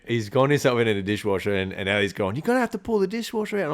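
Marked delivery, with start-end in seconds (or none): 2.4-3.7 fast, higher voiced line; quick high aside. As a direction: rattle it off as the other voice. Voicing the mouse/partner.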